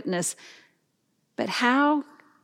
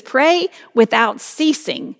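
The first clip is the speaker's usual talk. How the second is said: ringing proclamation; 5 semitones higher, 5 dB louder than usual. Acted. Preacher proclaims Paul's command.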